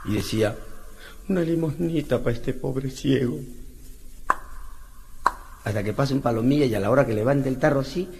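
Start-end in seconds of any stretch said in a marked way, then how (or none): 1.3-3.4 slow, soft whining beggar voice; soft slow beggar's whine; beggar's plea. Acted. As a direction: drag out the plea like a pitiful beggar. Blind beggar asking alms.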